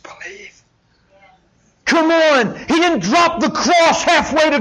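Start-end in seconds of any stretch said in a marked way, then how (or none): none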